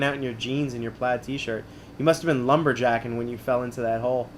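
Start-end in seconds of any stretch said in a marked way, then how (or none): none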